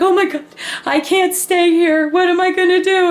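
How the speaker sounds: high panicky mock wail; mortified panic. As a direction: panic over the ruined bangs. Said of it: "I was mortified".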